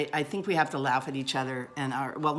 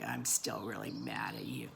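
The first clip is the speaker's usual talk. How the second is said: a much lower, softer grudging grumble; sulky grumble; 9 dB quieter than usual. Retold or imitated. Wife in the car grumbling at her husband that she's still angry. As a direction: grumble it low, not giving in. Refuses husband's peace offering.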